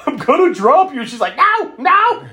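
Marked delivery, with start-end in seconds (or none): 0.0-1.0 high, loud panicked imitation; hammed-up cry of losing grip; mock strained warning. Acting Randy struggling with her. 1.4-2.3 very high, loud squeal; mock freak-out. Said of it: "Laverne and Randy freaking the fuck out".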